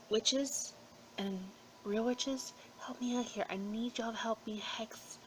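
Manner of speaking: hushed, plotting whisper; conspiratorial whisper. Real, not acted. Calling on witches to hex Trump, whispered. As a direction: whisper the plea like a spell.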